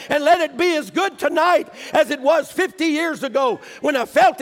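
loud, rising prayer shouted out to God; fervent prayer. Acted. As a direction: cry out to God with full force. Prays aloud in the sermon.

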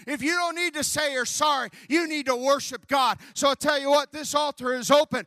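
loud, high revival shouting; preaching at full force. Acted. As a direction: whip the congregation up with fire. Altar call preaching.

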